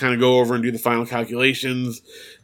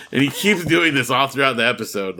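ordinary talk, nothing marked

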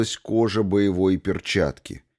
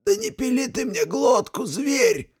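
gurgling alien voice. A narrator's reading. Archmage with blade at throat.

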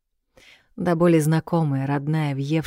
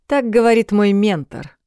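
louder, pointed male reply; firm retort; 6 semitones higher, 5 dB louder than usual. Acted. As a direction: say it firmly and a bit louder. Ренуарт отвечает резко. (твёрдо и громче)

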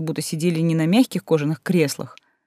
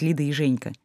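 ordinary delivery, nothing marked

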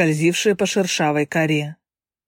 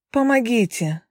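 desperate cry for help; 5 semitones higher than usual. A narrator's reading. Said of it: "В отчаянии закричала девушка".

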